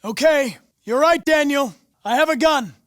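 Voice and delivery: conceding loudly in a standoff; tense call. Acted. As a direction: shout it to calm him. Hostage standoff on rooftop.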